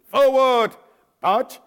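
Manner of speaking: high, loud drill command; marching command. Retold or imitated. Private ordering the platoon forward, loud command call. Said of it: "I gave the command".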